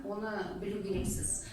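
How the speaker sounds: usual speaking voice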